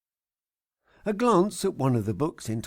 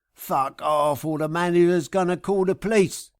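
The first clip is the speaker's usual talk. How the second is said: louder, higher, furious threat; angry shout; 5 semitones higher, 6 dB louder than usual. Acted. Parking guy threatening the trucker with police, loud and furious. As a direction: bark it to drive him off.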